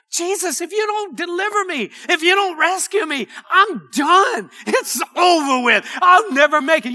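high plea building to a loud cry; desperate plea. Acted. Preacher voicing a believer begging Jesus for rescue, desperate. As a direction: cry out at the end of your rope. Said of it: "cry out in humility".

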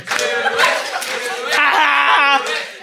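high screaming laugh from the burn; laugh-scream in pain; shrieking laugh. Real, not acted.

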